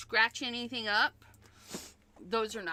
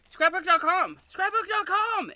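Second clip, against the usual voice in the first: ad plug; 7 semitones higher, 8 dB louder than usual. Acted. Plugging the shop like an ad.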